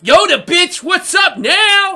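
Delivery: high, loud gloating taunt; shouted in-your-face bragging; mock triumphant yell. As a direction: crow it loudly, stretching the last words. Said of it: "So they were like".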